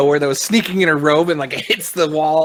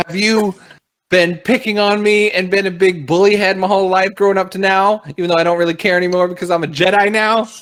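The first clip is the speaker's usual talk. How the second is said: high, loud run-on grievance; mock-aggrieved interrogation; acted accusing rant; 7 semitones higher, 5 dB louder than usual. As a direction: blurt out a pent-up complaint in one raised-pitch rush. Character's childish grievance during acted interrogation.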